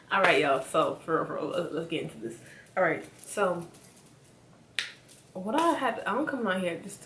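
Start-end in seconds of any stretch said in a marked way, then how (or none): none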